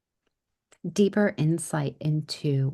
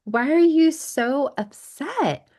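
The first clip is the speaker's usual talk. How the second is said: worried little daughter; 7 semitones higher, 4 dB louder than usual. Retold or imitated. Daughter asking upset mom what she did wrong, higher child's plea. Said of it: "she was like".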